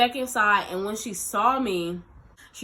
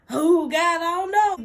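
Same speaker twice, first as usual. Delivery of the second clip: high, louder anxious cry; higher, panicky mock whine; anxious wail; 9 semitones higher, 7 dB louder than usual. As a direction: fret out loud, nearly wailing. Torn, panicked inner voice.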